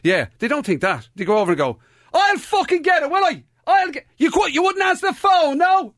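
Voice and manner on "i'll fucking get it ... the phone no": shouting. Retold or imitated. Housemate shouting at the lad in headphones, exasperated. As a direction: yell it like you've called him for ages. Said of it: "they're shouting at you with headphones on".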